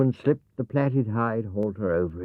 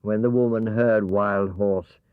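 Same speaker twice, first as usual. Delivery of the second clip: ordinary delivery, nothing marked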